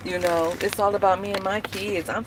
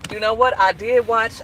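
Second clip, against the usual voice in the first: ordinary talk, nothing marked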